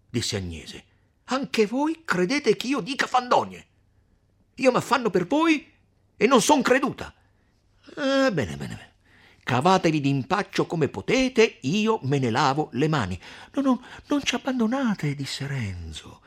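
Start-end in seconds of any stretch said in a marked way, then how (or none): 1.2-13.2 higher, louder huffy indignation; offended outburst. Acted. Agnese offended, quarrelling. 13.5-15.1 higher, louder earnest begging; pleading Renzo. Acted. Renzo begs Agnese not leave.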